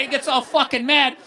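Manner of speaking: ordinary talk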